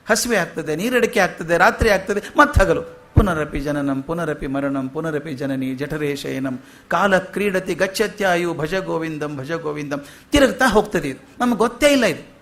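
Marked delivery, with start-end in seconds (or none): 3.1-10.0 slow, hushed recital of a Sanskrit verse; soft verse recitation. Acted. Preacher to audience, a slow soft verse recital. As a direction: let the verse flow gently and slowly.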